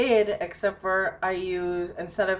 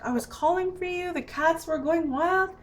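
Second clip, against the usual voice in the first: high mock-whiny reproach; acted indignant whine, much higher; retold plaintive complaint; 9 semitones higher than usual. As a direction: complain to a sleepy partner, pitched way up. Complaining to sleeping partner.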